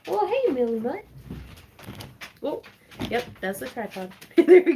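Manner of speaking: high, bright greeting to the dog; doggy voice. Real, not acted. Greeting Millie Mutt the dog in a high doggy voice. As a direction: coo at the dog as she trots up.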